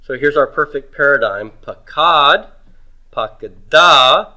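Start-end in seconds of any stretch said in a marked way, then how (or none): 1.6-2.5 Pakad with KAD drawn out and raised; stress on second syllable. Shown as a demonstration. Teacher recites the paradigm, Pakad stressed on the second syllable. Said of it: "we're stressing root two all the way through". 3.1-4.3 pakada slowly, with a loud, long final DA; stress on last syllable. Shown as a demonstration. Teacher shows the ending pulling the accent, pakada stressed hard on the last syllable. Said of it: "vocalic ending pulls it to the end".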